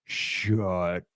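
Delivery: low, soft, drawn-out disbelief; breathless low shut up; stunned awe. Retold or imitated. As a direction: let it fall out in amazement.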